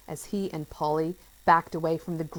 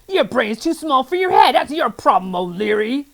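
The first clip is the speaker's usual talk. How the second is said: loud, higher contemptuous sneer; disgusted scorn; 6 semitones higher, 10 dB louder than usual. A narrator's reading. Mister Gum mocking Friday, loud disgust. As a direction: belittle him with disgust. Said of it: "shaking his head in disgust".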